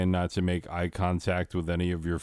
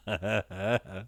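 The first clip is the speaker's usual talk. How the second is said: short burst of laughter. Real, not acted. Host cracking up at the slide, laughing. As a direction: break up laughing. Breaks up after restarting the intro.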